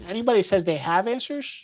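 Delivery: ordinary delivery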